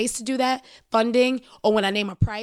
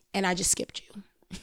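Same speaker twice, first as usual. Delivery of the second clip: sly unvoiced whisper, softer; a conspiratorial whisper; whispered aside; 7 dB quieter, slower than usual. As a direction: lean in and whisper the dig.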